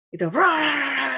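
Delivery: a slow, rising roar of the crowd; imitated rumble. Procession starting up again in the street, slow mock roar. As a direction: roar like the procession lurching back into motion.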